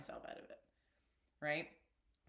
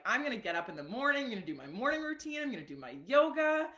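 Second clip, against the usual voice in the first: loud, high, fast fired-up plans; bursting with morning-routine hype; 6 semitones higher, 6 dB louder, faster than usual. Retold or imitated. Telling her friends how fired up she was, loud and excited. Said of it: "so fired up at this point".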